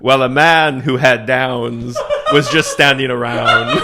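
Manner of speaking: slow, solemn mock poetry reading; mock poem recital. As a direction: recite it like a grave poet, slowly. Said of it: "it's a powerful poem".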